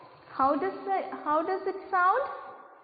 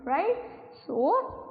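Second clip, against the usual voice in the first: ordinary talk, nothing marked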